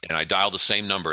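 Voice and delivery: ordinary talk, nothing marked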